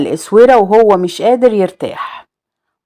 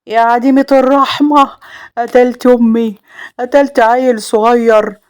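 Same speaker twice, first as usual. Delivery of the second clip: raised, anguished outrage; angry accusation; 4 semitones higher than usual. A narrator's reading. Salem striking his wife for killing his mother, angry accusation. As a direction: accuse her in high-pitched fury.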